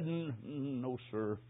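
a dying man's hoarse, faltering reply; retold hoarse whisper. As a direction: let the words barely scrape out, hoarse. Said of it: "Then a hoarse whisper".

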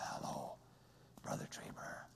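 very slow, toneless whisper with no voice at all; man without voice box. Retold or imitated. Preacher imitates friend who lost his voice box, voiceless whisper. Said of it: "His voice box has been removed".

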